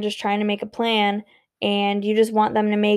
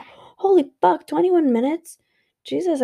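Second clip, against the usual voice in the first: shocked disbelief; 7 semitones higher than usual. Real, not acted. Shocked at recording length.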